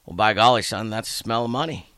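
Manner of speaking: put-on folksy old man. Old man explaining the smell to the newcomer, light folksy voice. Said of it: "And he goes".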